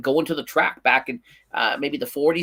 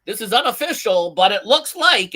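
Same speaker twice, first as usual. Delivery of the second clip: high-pitched mock TV announcer; lightly acted commentator, higher voice; race broadcast caller; 8 semitones higher than usual. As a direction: imitate a broadcaster relaying an unofficial result. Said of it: "they were like".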